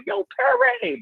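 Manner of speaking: high call, like hailing a friend from far off; calling out across the stands. Retold or imitated. Hailing friend Perry across the stands at the game, high call. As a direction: shout his name high to catch his attention.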